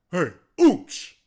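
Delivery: loud call across the room. Retold or imitated. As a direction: call his name loud. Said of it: "I hear Andy go".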